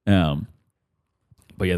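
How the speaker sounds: usual speaking voice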